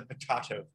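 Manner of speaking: "potato" said po-TAH-to, with a broad ah; po-TAH-to famine, as a vampire would say it; vampire pronunciation. Shown as a demonstration. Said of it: "Or they would say".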